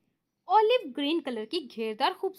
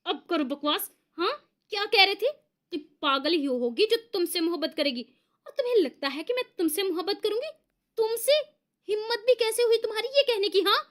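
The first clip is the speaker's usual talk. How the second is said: louder, higher furious scolding; enraged; 5 semitones higher, 3 dB louder than usual. Acted. Girl beating the boy with her clutch, enraged. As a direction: tear into him while hitting him. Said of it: "बिफरे हुए अंदाज में".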